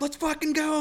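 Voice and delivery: high, loud mock battle cry; replayed fired-up challenge; retold combative yell. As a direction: goad him on at a high pitch. Retold shouted dare to attacker.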